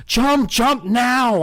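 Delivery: high, loud panicked shriek. A narrator's reading. Narrator urging Eddie away from rats, shriek. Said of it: "I shrieked".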